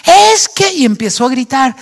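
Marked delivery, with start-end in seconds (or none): 0.1-0.7 a loud, high, half-mock outburst from the angry father. Imitating a furious father yelling at his noisy kids, mock yell. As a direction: burst out like the father losing his temper.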